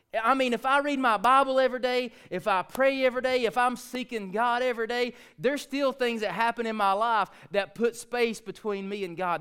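high, loud sermon climax; fervent preacher's rising shout; full-force preaching. Real, not acted. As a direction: preach it hard to the congregation.

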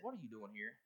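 low, soft voice of God; God gently asks the doubting believer why he is there; God asking, hushed. Pretend. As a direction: ask it quietly, almost under the breath. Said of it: "God looked at you and said".

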